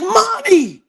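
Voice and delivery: high, loud call for mom. Retold or imitated. Daughter calling her mother from the terrace, high and loud. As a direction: shout up to mother with urgent news.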